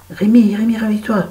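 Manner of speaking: high urgent call of the name; calling to wake him. Retold or imitated. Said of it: "parce que ça le réveille mieux". (appel pressant pour réveiller Rémi)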